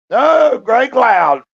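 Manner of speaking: loud, high greeting shouted to a viewer; calling out. Real, not acted. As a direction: call the name out big across the room. Calling out to a chatter.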